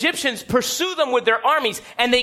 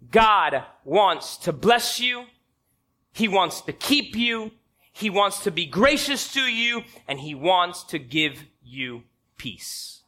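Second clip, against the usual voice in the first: slow blessing; 5 semitones lower, 3 dB quieter, slower than usual. Acted.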